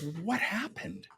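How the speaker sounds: high, slow, softened mock concern; imitated nosy girl. Girl asking the former influencer about his day job, high mock concern. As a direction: draw it out in a high girl's voice.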